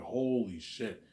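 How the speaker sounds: drawn-out shocked exclamation; slow stunned disbelief; slow stunned holy shit. Retold or imitated. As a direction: let it sink in slowly. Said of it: "I'm like".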